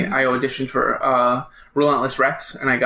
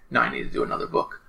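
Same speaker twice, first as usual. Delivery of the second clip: whispered to himself; 13 semitones lower, 6 dB quieter, faster than usual. Retold or imitated. Self-talk quote, unvoiced.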